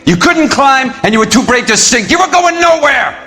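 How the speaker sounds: high, loud furious yelling; angry shouting. Acted. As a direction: hurl it at him in rage. Carlo confronted, shouting back.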